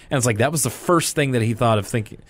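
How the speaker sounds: ordinary delivery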